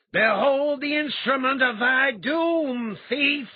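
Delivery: loud, high, slow roar; a burning madman threatening the thief. A narrator's reading. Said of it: "he roared".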